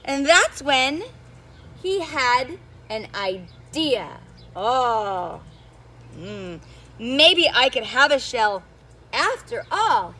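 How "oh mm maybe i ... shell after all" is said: lower, musing thought; thinking aloud. Acted. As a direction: turn the idea over slowly. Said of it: "he thought".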